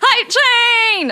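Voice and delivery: excited cheer. Real, not acted. Cheering hype train.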